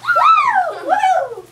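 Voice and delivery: high laughing whoops; cheerleader cheering. Real, not acted. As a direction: whoop it up, hyper and giddy. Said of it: "You two sound like cheerleaders on espresso".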